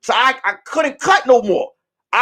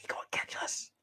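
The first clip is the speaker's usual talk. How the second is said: whisper; 22 dB quieter, faster than usual. Retold or imitated. Hiding, whispered.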